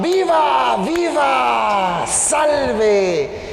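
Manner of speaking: high, long-drawn cheering calls; mock crowd cheer. Donkey retelling the crowd's welcome, high drawn-out cheers. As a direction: call out like an adoring crowd. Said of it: "todos me gritaban".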